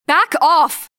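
loud, high startled shout; half shout. A narrator's reading. Taylor shouting at the unseen hands in the darkness. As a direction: shove them away with your voice. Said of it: "my voice raised as I half shouted".

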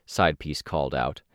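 ordinary delivery, nothing marked

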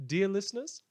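big, high and loud opening address; grand announcement. Acted. Radio host booming a welcome to his listeners. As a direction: throw the greeting out to the whole city.

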